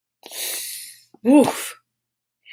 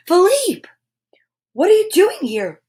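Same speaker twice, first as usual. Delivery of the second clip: higher, startled call to the horse; higher, alarmed Belle calling her horse; alarmed cry; 8 semitones higher than usual. Acted. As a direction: call out in sudden worry. Belle finds the horse alone.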